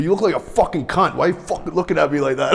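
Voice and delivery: a hint of a sneering old biker, laughing through it; mock the counselor's insult, half laughing; retelling how the biker counselor called him out in group, laughing mock insult; imitating gruff biker.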